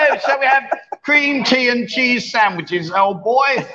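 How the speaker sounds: hooray Henry toff. Retold or imitated. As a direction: ham up a posh cricket gent, slowly. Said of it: "Cricket is a hooray Henry spot".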